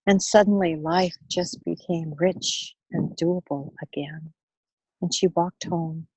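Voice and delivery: plain reading voice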